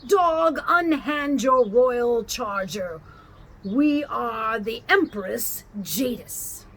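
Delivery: an empress commanding the cabby, loud and cold; cold, ringing loud. A narrator's reading. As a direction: ring out over the crowd like an empress. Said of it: "ringing loud above all the other noises".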